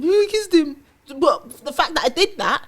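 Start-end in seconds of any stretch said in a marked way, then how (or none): 0.0-0.8 mock excited confession. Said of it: "I come home and".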